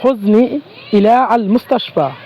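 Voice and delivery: drawn-out model phrase; slow careful demo. Shown as a demonstration. Teacher models the Arabic hospital request slowly for learners. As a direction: say হুজনি ইলা আল মুস্তাশফা slowly and clearly. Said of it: "তাহলে আমরা বলতে পারি".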